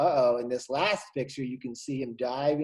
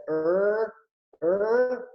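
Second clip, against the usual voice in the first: confused; 6 semitones higher, 3 dB louder than usual. Acted. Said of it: "like he's confused".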